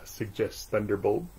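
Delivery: usual speaking voice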